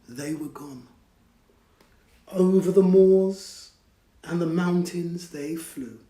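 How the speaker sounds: plain reading voice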